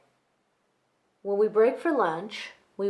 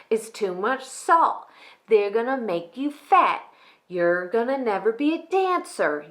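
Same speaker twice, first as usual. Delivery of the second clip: higher-pitched mock lecture, building louder; hammed-up scolding that swells into dismissive yada-yada; scolding teacher; 3 semitones higher, 5 dB louder than usual. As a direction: mimic a nagging dance teacher's reprimand. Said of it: "would scold me for having those pickles".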